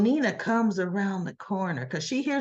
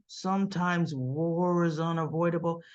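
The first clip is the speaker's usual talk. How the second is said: lower, softer mock-grave line; ominous declaration; 6 semitones lower, 5 dB quieter than usual. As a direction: deliver it as a quiet, low warning. Re-acting Nina's ominous line.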